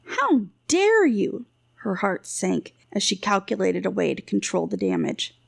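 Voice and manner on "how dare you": high, drawn-out outraged protest; indignant. A narrator's reading. Wife accused of stealing answers back, indignant. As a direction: stretch it out in high-pitched affront.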